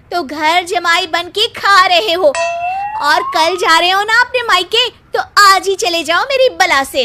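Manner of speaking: loud, rising scold at a lazy husband; fed-up wife shouting him out of the house; angry scolding. Acted. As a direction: tell him off harder with each line.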